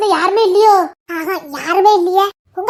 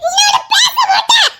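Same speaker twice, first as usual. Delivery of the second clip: angry shouting; 13 semitones higher than usual. Acted. Daughter refuses angry father.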